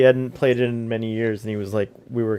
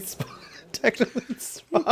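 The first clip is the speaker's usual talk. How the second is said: laughing; 11 semitones higher, 7 dB quieter than usual. Real, not acted. Laughing while correcting a friend.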